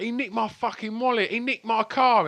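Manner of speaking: high, loud outraged complaint; indignant shout. Retold or imitated. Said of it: "everyone was like".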